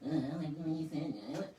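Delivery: hammed-up whine of hurt feelings; mock touchy-feely. Mocking people who need everything touchy-feely, put-on whine. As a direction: imitate an oversensitive person moaning. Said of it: "doesn't have to be so touchy-feely".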